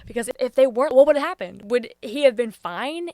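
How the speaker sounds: frustrated loud rant; exasperated outburst. Real, not acted. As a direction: let the frustration boil over.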